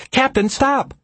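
very high, urgent scream. A narrator's reading. Linda to the captain as the plate loosens, scream. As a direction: cry out to halt the captain, pitch way up. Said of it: "screamed Linda".

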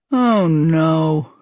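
long, drawn-out cartoon dad groan of a line; held, sinking Homer dismay. A narrator's reading. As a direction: stretch each word out as Homer. Voicing Homer in script.

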